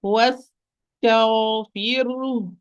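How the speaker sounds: واستغفر drawn out very slowly, letter by letter; slow motion. Shown as a demonstration. Teacher models واستغفر in slow motion for student. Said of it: "pronounce it slow motion".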